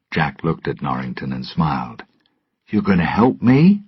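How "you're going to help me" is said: happily. Acted. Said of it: "he said happily".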